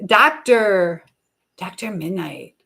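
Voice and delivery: ordinary delivery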